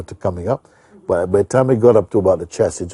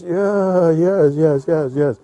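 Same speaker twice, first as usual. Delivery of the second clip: much higher, enthusiastic yeses; eager agreement; 11 semitones higher, 5 dB louder, much slower than usual. Retold or imitated. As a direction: give in with delight, lightly voiced. Old man agreeing, convinced.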